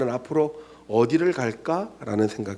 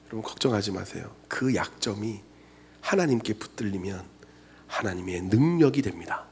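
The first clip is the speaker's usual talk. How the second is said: tender reassurance; 6 semitones lower, 5 dB quieter than usual. Acted. Tender preaching.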